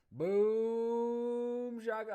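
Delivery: booming BOOM held long and high; drawn-out catchphrase. Real, not acted. Host playing for time on livestream, drawn-out catchphrase.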